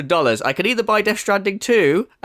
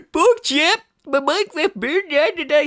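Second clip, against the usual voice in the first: much higher, whiny mock wail; mock sobbing Phil; 10 semitones higher than usual. Mocking Phil crying over Minecraft on a night out, high wail. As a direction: wail it like a blubbering drunk mate. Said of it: "mocking Phil crying drunk".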